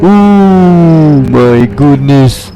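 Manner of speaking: long, stretched-out exclamation of shock; slow, wailing disbelief; drawn-out astonishment. Real, not acted. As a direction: stretch the first word in amazement. Reacting to giant ape customer.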